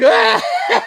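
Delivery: imitating the villain's scream in the movie; villain's roar. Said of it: "he's like".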